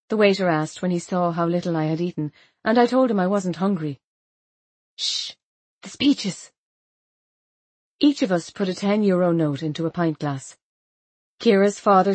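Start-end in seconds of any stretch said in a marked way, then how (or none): none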